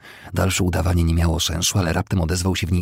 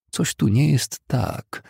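slow, high uneasy thought; growing unease; 8 semitones higher, slower than usual. A narrator's reading. Homer sensing something wrong at the duel, uneasy. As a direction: think it slowly, uneasily. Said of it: "myślał z rosnącym niepokojem".

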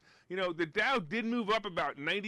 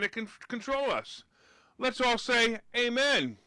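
rally the listeners like a preacher; preachy prayer; 4 semitones higher than usual. Real, not acted. Host leading viewers in prayer, preaching.

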